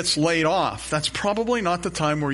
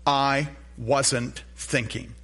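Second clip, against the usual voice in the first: slow, deliberate three words; slow confession; much slower than usual. Shown as a demonstration. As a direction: let each word land slowly. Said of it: "in three words".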